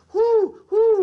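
high hooting owl who. Acted. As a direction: hoot it like a wise old owl. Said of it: "just think of the wise old owl".

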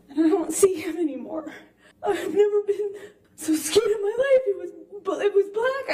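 fighting back tears while telling it; voice breaking. Real, not acted. Reliving the assault, breaking down.

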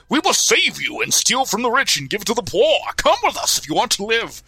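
grand, high heroic proclamation; heroic outlaw voice. Acted. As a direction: declaim it like a noble outlaw. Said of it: "and they go".

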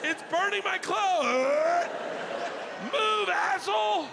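a dad sure the smoke is poison, barking orders to keep moving; panicked yelling dad. Retold or imitated. Dad to family in the smoke-filled escape room, high panicked yelling.

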